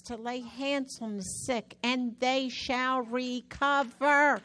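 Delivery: preaching. Acted.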